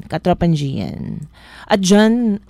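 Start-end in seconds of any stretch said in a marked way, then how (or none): none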